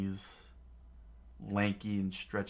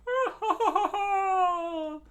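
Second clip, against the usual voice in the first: high, loud cackling; hammy villain crew cackle; mocking crew laughter; 7 dB louder than usual. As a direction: laugh scornfully at the victims. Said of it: "started screaming in laughter".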